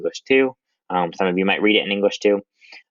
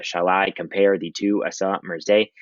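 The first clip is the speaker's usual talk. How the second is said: "Shall I compare thee to a summer's day" in a measured da-DUM beat; slowish, stressing every second syllable of "Shall I compare thee to a summer's day"; iambic rhythm. Shown as a demonstration. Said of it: "his poetry all reads like that".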